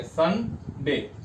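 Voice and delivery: "Sunday" very slowly, as separate "सन" and "डे"; teacher counting the two syllables of "Sunday" with a gap; split into syllables. Shown as a demonstration. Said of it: "इसमें पद कितने हो गए".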